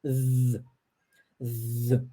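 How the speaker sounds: a long buzzing z, tongue between the teeth; buzzing voiced th sound. Shown as a demonstration. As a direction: hold the buzz so it vibrates. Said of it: "ele possui esse som".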